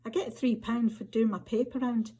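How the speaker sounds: measured, intimate Scottish storytelling read; story narration. A narrator's reading. Author reading to an audience, story narration. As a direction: author reading her flash fiction aloud, unhurried and close. Reads second story aloud.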